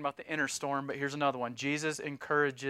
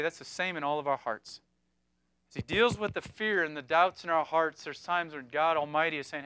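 ordinary delivery

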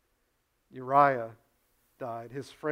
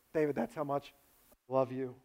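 tender; 7 dB quieter than usual. Retold or imitated. God speaking tenderly to David.

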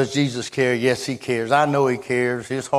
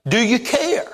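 pleading cry; 11 semitones higher, 4 dB louder than usual. Retold or imitated. Preacher voicing disciples' fear.

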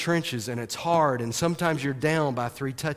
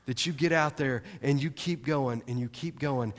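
ordinary talk, nothing marked

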